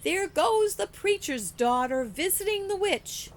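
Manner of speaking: put-on high, loud jeering shout from kids; loud, high singsong taunt of mocking boys; taunting holler. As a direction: yell it across the street to tease. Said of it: "They would holler".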